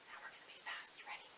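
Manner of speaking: hushed aside before the fast part; whisper. Real, not acted. Teacher tells the children, whispered. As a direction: whisper it to build anticipation.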